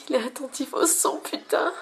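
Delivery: high, thrilled exclamation; excited. Real, not acted. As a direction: gush with excitement. Thrilled by the trait.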